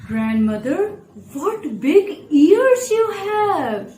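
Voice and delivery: put-on high, slow, wide-eyed child voice; drawn-out child's amazement; a little girl asking grandma in wonder.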